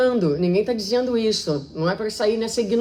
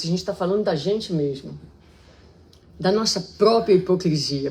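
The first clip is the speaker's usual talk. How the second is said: ordinary talk, nothing marked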